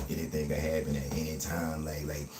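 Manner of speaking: ordinary talk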